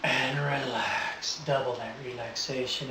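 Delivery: soft hypnotic deepening; calm, slow trance voice. Real, not acted. Live induction deepening.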